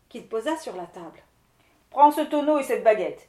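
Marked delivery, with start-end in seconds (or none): 1.9-3.3 louder, firm handing-over; a hint of the commanding wind lord. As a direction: give it with authority. Wind lord hands over gift.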